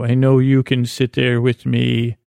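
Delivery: usual speaking voice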